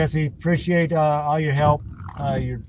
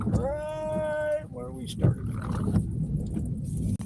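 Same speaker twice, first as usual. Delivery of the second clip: high drawn-out sing-song; 4 dB quieter, much slower than usual. Real, not acted. Laughing comment on circling.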